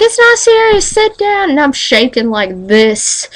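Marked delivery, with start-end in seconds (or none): 0.0-1.5 mom's voice. Retold or imitated. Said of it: "She was like".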